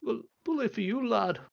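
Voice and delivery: high, dry mock praise; sarcastic dwarf. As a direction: brush him off with scorn.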